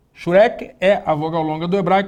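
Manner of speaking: ordinary talk, nothing marked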